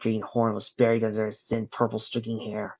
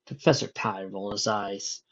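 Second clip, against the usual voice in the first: ordinary delivery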